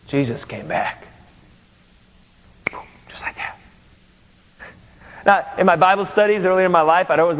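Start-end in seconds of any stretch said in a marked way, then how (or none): 0.1-1.1 hushed slow reveal. Acted. Dramatic reveal after pause. 3.1-3.6 whispered echo, breathless awe. Acted. Preacher whispers the return of Jesus to the congregation, awed.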